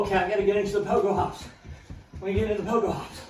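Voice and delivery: quick hurried inner voice; rushed, talking to yourself; fast self-talk. Retold or imitated. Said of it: "and then you go".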